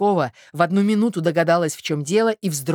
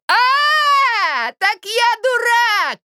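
high, loud, drawn-out cry of rage; furious shout; 7 dB louder, much slower than usual. A narrator's reading. Rejected suitor to Zina, furious shout. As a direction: explode at being called a fool. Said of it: "кричал он".

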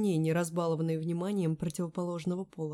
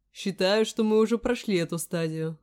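loud, amused smirk of a line; 2 semitones higher, 9 dB louder than usual. A narrator's reading. Caller smirking at her formality. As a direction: say it with a grin. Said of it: "усмехнулся собеседник".

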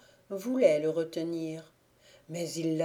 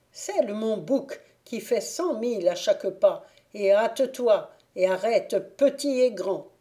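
louder, higher commanding fairy; angry fairy queen giving a command; furious order; 4 semitones higher, 6 dB louder than usual. Acted. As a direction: bark orders at the squire in a rage. Furious fairy orders her squire.

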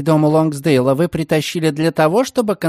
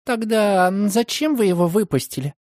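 higher, uncertain question; young woman voice; 6 semitones higher than usual. A narrator's reading. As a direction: ask hesitantly. Margaret asks anxiously. (неуверенный девичий голос)

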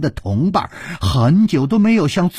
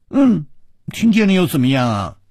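defiant; 6 semitones higher, 3 dB louder than usual. Acted. Girl defiantly retorts.

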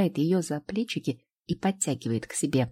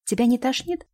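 panicked mother; 6 semitones higher, 3 dB louder than usual. Acted. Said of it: "Голос Гали дрожит".

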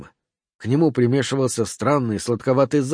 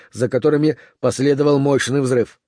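plain reading voice

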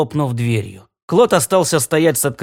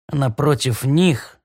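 usual speaking voice

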